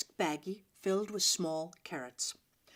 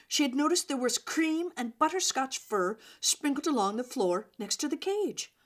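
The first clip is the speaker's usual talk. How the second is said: plain reading voice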